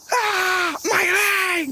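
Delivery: high yelp of fake pain; mock pained yelp. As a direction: cry out as if your leg hurts. Mock injury cry in vlog.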